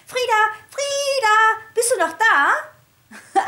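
high, loud, slow anxious call; excited calling. A narrator's reading. Piglet Mona calls excitedly for Frieda in the kitchen. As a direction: call out across the house, agitated. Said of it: "ganz aufgeregt nach ihr rufen".

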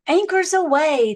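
high, loud pirate captain's cry; captain cries out. Acted. Captain calls his crew to set sail, loud high cry. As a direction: bellow the order across the deck. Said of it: "the captain cries".

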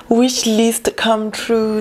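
ordinary talk, nothing marked

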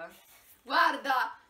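usual speaking voice

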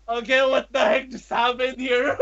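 baffled words through giggles; amused bafflement. Retold or imitated. Fan retelling strange encounter, amused bafflement. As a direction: laugh through a retold confused reaction.

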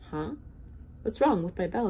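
usual speaking voice

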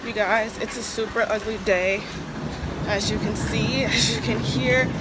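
talking loudly over heavy rain outdoors. Real, not acted. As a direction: project over the downpour.

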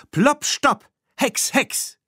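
very high, punchy incantation; called-out spell. A narrator's reading. Bibi hexing the charging bull, called-out spell. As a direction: cast an emergency spell in a high voice. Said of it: "rief sie".

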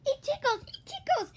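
high, giggly squeal; ticklish laughter. Acted. Snake being punched by Toad, high ticklish giggling. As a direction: squeal it, breaking into hee-hee giggles. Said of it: "The snake laughed".

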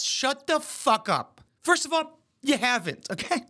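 heated mock outrage; exasperated rant. Real, not acted. Ranting at the video.

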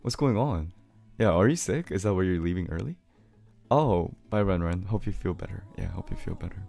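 ordinary talk

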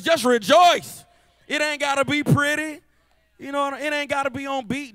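loud, high, slowed exhortation; preacher at full force; rousing shout. Real, not acted. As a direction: fire up the crowd.

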